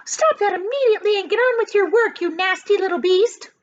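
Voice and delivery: high, loud, fast angry command; furious old aunt; Aunt Sponge ordering. A narrator's reading. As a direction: bark orders at the boy. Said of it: "Aunt Sponge ordered".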